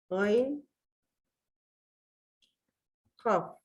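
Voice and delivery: letters read thick, one by one. Shown as a demonstration. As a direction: spell each letter out with a heavy, thick sound. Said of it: "Yedi tane kalın harfimiz var". (kalın harfler tek tek okunuyor)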